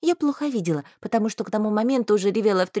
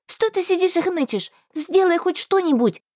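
higher, sharp rebuke; scolding; 8 semitones higher than usual. A narrator's reading. Heroine scolding Marston, sharp and high. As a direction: shake him out of it.

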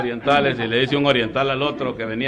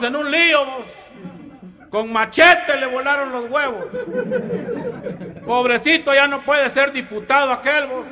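high country bumpkin voice; 6 dB louder than usual. Acted. Comedian voicing a man from eastern Guatemala gossiping to his friend, very high and loud.

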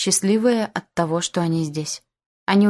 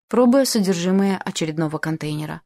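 ordinary delivery, nothing marked

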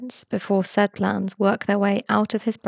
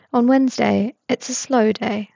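plain reading voice

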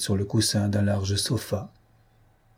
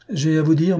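higher and louder, hitting "vous"; forceful; 6 semitones higher, 4 dB louder than usual. Acted. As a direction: fling the words at the marquis. Saint-Georges' solemn challenge opens. (Saint-Georges lance son défi, plus haut et plus fort)

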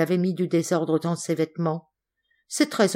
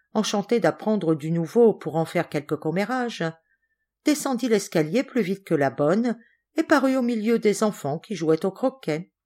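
usual speaking voice

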